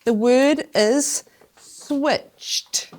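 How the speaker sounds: teacher slowly announcing the challenge word; slow announcement. Shown as a demonstration. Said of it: "announcing challenge word".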